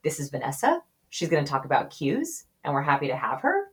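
cues and her rise at the end like questions; question inflection intro. Shown as a demonstration. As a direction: introduce her as if unsure, lifting each phrase end. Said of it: "if you were to introduce me".

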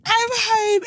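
retold high-pitched elation; overjoyed. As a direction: gush it, reliving the joy. Repeats the delighted reaction.

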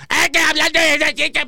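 high mocking imitation of his cohost. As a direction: mimic him in a high, teasing voice. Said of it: "tú dices".

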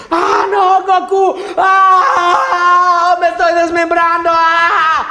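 drawn-out, high, hammed-up death scream; mock melodramatic dying shriek; exaggerated endless scream. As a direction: overdo an eternal agonized scream, high and loud. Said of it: "tienen en la mente que es así de".

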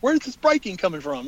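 high-pitched exasperated outburst; incredulous high-voiced complaint; retold exasperation. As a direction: relive the alarm, pitch jumping up. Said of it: "And I was like".

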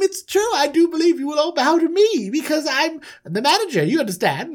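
high, chirpy condescension; self-important manager. Acted. Manager telling brothers they will bow, high and smug. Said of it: "'cause he's like".

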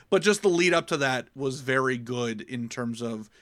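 ordinary talk

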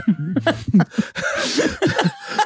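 high-pitched burst of real laughter; amused laughter. Real, not acted. Laughing after being told it's depressing, helplessly amused. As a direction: crack up for a couple of seconds.

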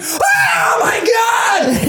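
very high, drawn-out crying shriek; hammed-up wailing scream; horrified scream. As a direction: scream in acted horror, stretching every word. Child watching rats being slaughtered.

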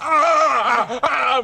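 imitating his own groans after taking the impact; mock pained groans. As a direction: act out the crash with hurt yelps. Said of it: "Dude, I was like".

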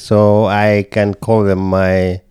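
ordinary talk

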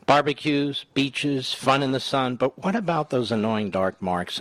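energetic infomercial pitch. Acted. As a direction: sell it like a TV ad. Host reads sponsor ad.

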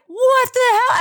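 high, loud mock-alarmed outburst; jolted out of sleep, alarmed; retold panicked wake-up; startled. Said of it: "And I was like".

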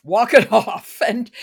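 bursting into a loud laugh mid-phrase. Real, not acted. Host jokes about her own motto.

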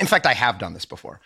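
ordinary talk

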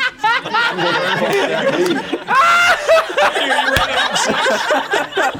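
high shrieking laughter. Real, not acted. Group laughs at Andy.

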